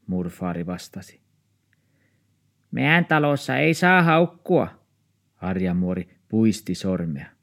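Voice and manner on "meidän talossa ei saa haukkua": put-on high scolding old woman; strict old neighbour. Old neighbour warns the dog owner, high and scolding. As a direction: wag a finger as you say it.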